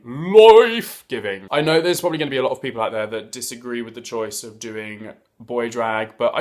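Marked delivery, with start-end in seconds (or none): 0.0-1.5 LIFE stretched long, high and loud; campy drawn-out punch on "Life"; gushing emphasis. Real, not acted. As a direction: belt out "Life", then drop on "giving". Repeats praise, hugely stretched and louder.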